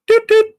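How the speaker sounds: high, loud doot-doot whistle sound; playful whistle imitation; imitated whistle. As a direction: chirp it out like a cheerful little whistle. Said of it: "walks in with his little whistle".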